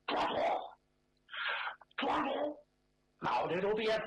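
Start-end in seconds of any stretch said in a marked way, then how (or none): 0.1-2.6 put-on old steward's call. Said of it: "我得学这老管家".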